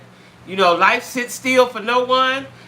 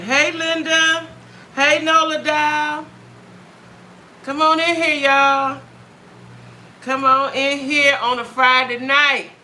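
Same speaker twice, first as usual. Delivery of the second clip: excited greeting; 7 semitones higher, slower than usual. Real, not acted. Greeting viewers joining live stream.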